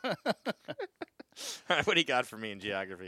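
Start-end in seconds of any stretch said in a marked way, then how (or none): 0.2-1.6 high laugh, a little softer. Real, not acted. Host joking about Boston accents, high laughter. Laughs after Whitey Bulger joke.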